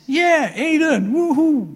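high, loud cheering yeah woehoe; mock cheer. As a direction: cheer it like a party crowd. (gespeeld gejuich)

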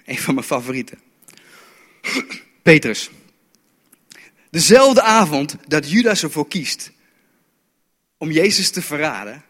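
usual speaking voice

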